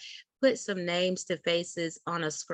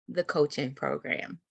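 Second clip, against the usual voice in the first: usual speaking voice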